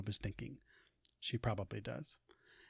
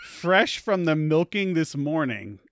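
put-on higher, louder, slower old grandmother voice; laughing grandmother, higher and louder; teasing grandmother; 4 semitones higher, 8 dB louder, slower than usual. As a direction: play the fond old grandmother teasing her son. Grandmother voice again.

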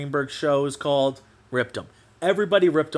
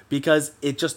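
ordinary delivery

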